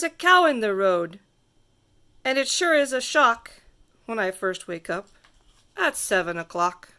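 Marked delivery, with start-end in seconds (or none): none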